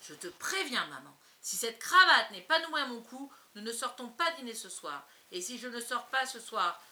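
sévère. Acted. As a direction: menace sévère, nettement plus forte. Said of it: "il poursuivit avec sévérité". (mari qui menace sa femme, ton sévère et fort)

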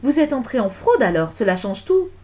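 loud outraged reproach; indignant accusation. Acted. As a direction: accuse her as a scandalised patroness. Lady accuses intruder.

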